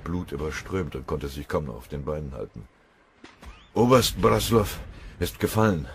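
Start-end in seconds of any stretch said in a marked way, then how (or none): 3.7-5.9 息切れした報告; panting gasp. Acted. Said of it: "stieß er keuchend hervor".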